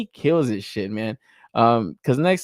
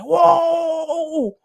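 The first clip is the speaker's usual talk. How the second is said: high, drawn-out mock crowd whooping; loud, stretched-out whoa of mock amazement; imitated hollering; 14 semitones higher, 7 dB louder, much slower than usual. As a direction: imitate hyped-up fans hooting and hollering. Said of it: "hooting and hollering like".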